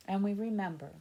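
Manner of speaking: ordinary delivery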